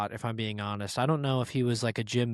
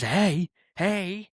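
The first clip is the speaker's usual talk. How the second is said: raised-pitch mock holler; higher, called-out imitation; imitated yell; 6 semitones higher than usual. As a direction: call out like a stranger from the woods. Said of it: "who yelled at me".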